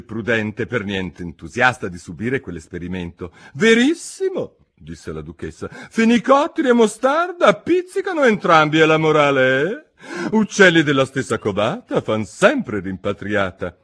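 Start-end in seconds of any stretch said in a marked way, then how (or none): 3.5-4.6 higher, louder, pompous duchess. A narrator's reading. Duchess lectures Alice, pompous and higher. 5.7-13.8 higher, louder, pompous duchess. A narrator's reading. Duchess lectures Alice, pompous and higher.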